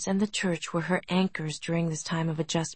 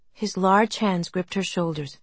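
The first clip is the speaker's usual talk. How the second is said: ordinary delivery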